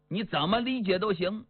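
plain reading voice

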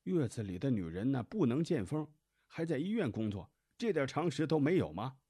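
high, loud indignant rebuke; indignant mother-in-law. A narrator's reading. Mother rebukes the nurse over postpartum rules, indignant and high.

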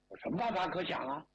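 plain reading voice

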